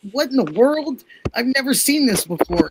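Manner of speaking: high, disbelieving; baffled. Retold or imitated. Wife shocked husband won't say love you, high disbelief. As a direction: throw up your hands in disbelief. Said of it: "And I'm, I'm like".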